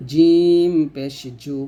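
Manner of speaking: জিম পেশ জু drawn out; letter drill; slow demo syllable. Shown as a demonstration. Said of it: "যেমন".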